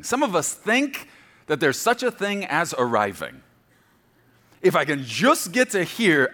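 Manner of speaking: loud, high, full-force preaching. Acted. Preaching to the congregation, loud and high. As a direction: preach it out to the church about arriving.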